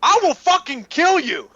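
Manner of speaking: high, loud, slow menace; mock threat. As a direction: growl it like a hammy villain. Imitating Zach's threats.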